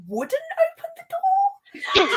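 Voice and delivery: high, slow, laughing words; giggling high confession. Real, not acted. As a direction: laugh through the admission.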